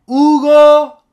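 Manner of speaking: HUGO yelled long, high and loud; drawn-out loud call of Hugo; shouting the name. Shown as a demonstration. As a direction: holler Hugo with a stretched ending. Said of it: "Mas se for para gritar".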